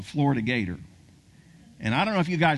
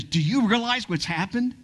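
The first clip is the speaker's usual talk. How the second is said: excited publicist; 7 semitones higher than usual. Acted. Publicist rushing up to Tebow.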